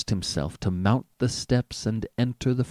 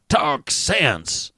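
curt, louder rebuke; sharp; 4 semitones higher, 4 dB louder than usual. A narrator's reading. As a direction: snap at him to stop babbling. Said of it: "said Doctor Keene sharply".

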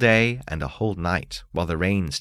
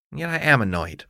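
ordinary delivery, nothing marked

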